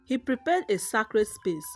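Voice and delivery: ordinary delivery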